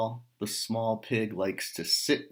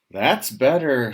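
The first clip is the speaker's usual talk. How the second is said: pleased farmer's wife; 3 semitones higher, 10 dB louder than usual. Acted.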